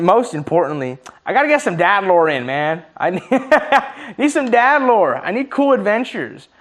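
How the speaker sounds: ordinary delivery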